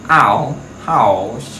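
ảo, house with the vowel stretched a-u very slowly; sound the diphthong. Shown as a demonstration. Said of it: "A, U".

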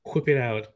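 "Whip" begun with a blown "hw"; modeled WH in whip. Shown as a demonstration. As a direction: show off the airy start of "Whip". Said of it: "I can't pronounce it like that".

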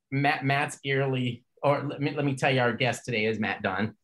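usual speaking voice

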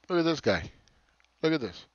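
usual speaking voice

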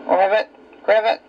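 quick croaking ribbit; frog voice. Shown as a demonstration. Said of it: "Can you croak like a frog?".

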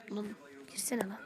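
unvoiced whisper aside. Real, not acted. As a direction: whisper it off to the side.

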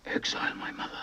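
a shocked, breathless whisper; stunned whisper. Acted. Son whispering in shock at his father's order. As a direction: barely get the words out.

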